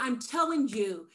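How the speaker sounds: usual speaking voice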